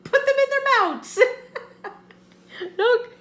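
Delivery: high, loud talk through laughter; laughing hard. Real, not acted. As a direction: shriek it while cracking up. Cracking up at the video.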